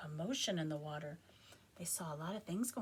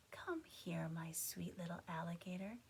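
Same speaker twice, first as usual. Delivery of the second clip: soft tender whispered mother voice; gentle whisper; 5 dB quieter than usual. Acted. Mother alligator gently calls her baby. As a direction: whisper it lovingly to your baby. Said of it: "in a voice as gentle as the whispering grass".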